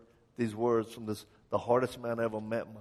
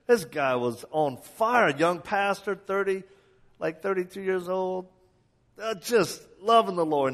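higher pitched, rising enthusiasm; fired-up preaching; 8 semitones higher, 7 dB louder than usual. Acted. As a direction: build excitement about him. Excited praise of friend.

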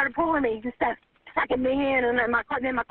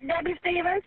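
usual speaking voice

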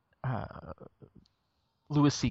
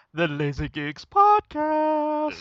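host intro; much slower than usual. Acted. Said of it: "podcast intro drawn out".

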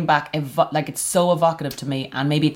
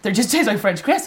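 delighted surprise; 6 semitones higher than usual. Retold or imitated. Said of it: "I was like".

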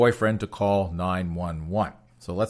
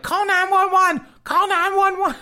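very high, slow, louder squeal; mock panicked plea; 6 dB louder than usual. Host voicing the victim begging for help, high and shrill-pitched. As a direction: wail it like a silly emergency. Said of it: "she's like".

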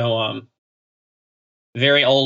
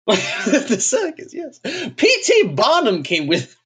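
much higher, slow posh reminiscing voice; mock old posh Brit; 12 semitones higher, slower than usual. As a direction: recall the circus like an ancient snob. Joining the toff bit.

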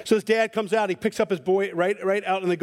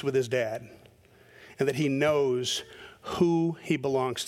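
low, soft intimate sermon; a pastor softly asking his flock to reflect; reflective preaching; 7 semitones lower, 4 dB quieter than usual. Acted. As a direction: draw the congregation in quietly.

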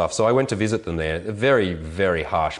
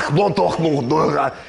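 high, loud guttural Klingon-style growl; drunk Klingon-like miners; 7 semitones higher, 4 dB louder than usual. Retold or imitated. Mocking the drunk miners in the bar, high guttural growl. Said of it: "they sound like Klingons".

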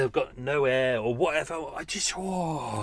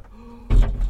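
deep gasp of air; 10 dB louder than usual. Real, not acted. Opens window for fresh air.

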